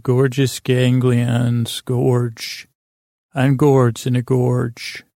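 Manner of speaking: very slow, drawn-out words, pausing after "gorge"; slow tongue twister. Shown as a demonstration. Host repeats the twister he likes, slowly and deliberately. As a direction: savour each word of "Gorgeous ganglion's gorge". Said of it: "trying to do a tongue twister".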